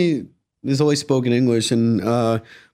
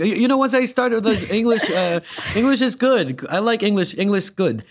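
higher, halting joke English; mock stuttering; 7 semitones higher than usual. Guest jokes about mastering English, mock stuttering. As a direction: stumble playfully over simple English. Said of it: "I'm stuttering".

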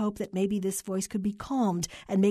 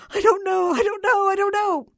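high, loud, frantic repetition; distraught caller beside herself; hysterical wailing; 13 semitones higher, 8 dB louder than usual. A narrator's reading. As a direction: shriek it helplessly at the clerk. Hysterical caller answers clerk again.

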